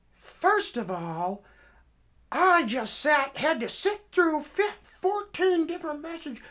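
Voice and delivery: loud, very high, slow acted officer's tirade; indignant scolding. Officer berates caller over fourteen messages, indignant. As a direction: imitate an exasperated officer, stretching the words.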